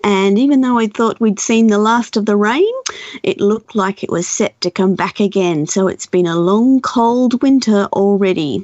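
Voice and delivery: ordinary talk, nothing marked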